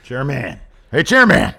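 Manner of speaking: loud, high call to the chairman. Pretend. Mock call to chairman McMahon, loud and high. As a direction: shout for the boss across the room.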